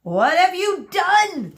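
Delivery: loud, high, drawn-out outrage; screamed. A narrator's reading. Hairdresser blames Medusa for his hands, screamed. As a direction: cry out in horrified accusation. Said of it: "screamed Monsieur Joseph".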